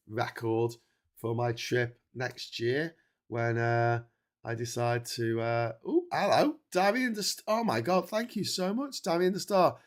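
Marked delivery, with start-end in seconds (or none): 5.9-9.8 delighted surprise. Real, not acted. Thanking a donor on stream.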